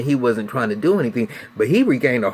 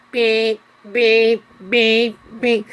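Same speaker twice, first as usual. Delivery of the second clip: high, sharp beep-beep of a truck reversing; piercing mock backup beeps; imitated truck beeps; 9 semitones higher, 3 dB louder, much slower than usual. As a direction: mimic the reverse alarm. Tow truck backing up.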